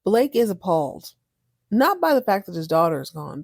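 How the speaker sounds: ordinary talk, nothing marked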